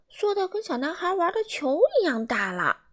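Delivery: usual speaking voice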